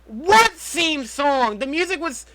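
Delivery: high, loud indignant outburst; incredulous. Real, not acted. Answering a co-host about the theme song, incredulous outburst.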